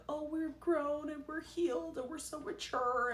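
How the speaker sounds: high, sing-song mock of smug maturity; mocking quote. Mocking the couple's video claims in a high sing-song. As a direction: sneer it like a fake-healed couple. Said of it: "trying to paint this video like".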